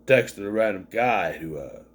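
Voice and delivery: low, slowed co-worker voice, lightly imitated; retold co-worker. Reviewer quoting the drunk co-worker's confession, low retold voice. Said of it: "He's just like".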